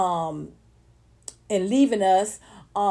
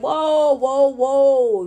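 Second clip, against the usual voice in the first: loud, drawn-out whoa; retold loud interjection to stop the chatter; calling a halt; 3 semitones higher, 6 dB louder, much slower than usual. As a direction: cut in loudly, stretching it out. Recounts her interjection to stop accusers.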